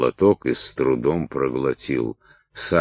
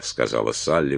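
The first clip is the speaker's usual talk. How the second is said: plain reading voice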